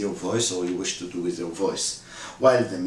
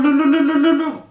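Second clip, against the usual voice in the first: very high, loud trilling lu lu lu; high ululation; 8 dB louder, much faster than usual. Shown as a demonstration. Teacher demonstrating women's high wedding ululation, very high and loud. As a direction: ululate with the highest voice you have. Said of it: "this woman doing the lu".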